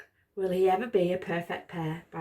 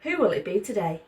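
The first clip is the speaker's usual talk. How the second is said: ordinary delivery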